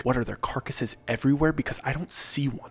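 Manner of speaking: usual speaking voice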